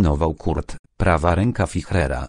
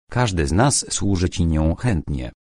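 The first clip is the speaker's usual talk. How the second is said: usual speaking voice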